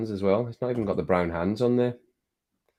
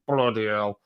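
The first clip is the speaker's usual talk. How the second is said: normal talking voice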